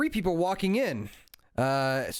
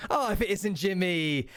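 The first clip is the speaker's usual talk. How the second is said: high, welcoming exclamation; shopkeeper greeting regulars; 4 semitones higher than usual. Acted. Shopkeeper greets three brothers walking in, high exclaimed welcome. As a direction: greet each name in turn, pitched up. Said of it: "Kramer goes".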